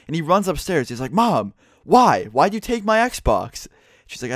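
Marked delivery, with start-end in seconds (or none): 1.1-3.7 high-pitched, drawn-out plea from a wronged son; whiny accusing son. Retold or imitated. Son storming upstairs to accuse his mom, whiny and high. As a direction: stretch out the why like a kid who's been cheated.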